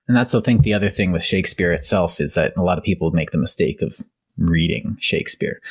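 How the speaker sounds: ordinary talk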